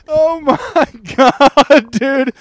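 high-pitched, near-tearful laughter through the words; laughing hard. Real, not acted. Podcaster hails the funniest bit ever, laughing helplessly. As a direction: struggle to talk while cracking up.

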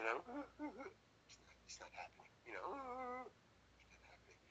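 whispered aside to himself; hushed panic. Retold or imitated. Actor failing to cry on cue, hushed and whispered. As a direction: imitate a stuck actor, barely audible.

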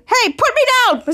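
high-pitched mock complaint; acted protest. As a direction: protest like you've been hoisted up. Retold lifted character protests.

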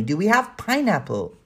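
put-on child's shout. A narrator's reading. Danny asks the housekeeper for pineapple, mildly shouting. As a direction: call out the question like an eager child. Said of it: "shouted Danny".

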